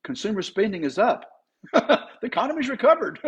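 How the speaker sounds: high, fast newscaster parody; mock news report. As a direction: chirp it like a news headline. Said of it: "It sounds like this".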